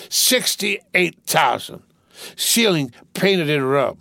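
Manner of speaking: put-on slurred, giggly drunk rich boy. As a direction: play a rowdy drunk young gentleman. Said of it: "I'm drunk".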